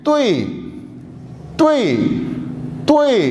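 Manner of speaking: careful tonal demo; Mandarin word drill. Shown as a demonstration. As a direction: model तुई for the class three times. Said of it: "आई की sound ज़्यादा नुमाया है".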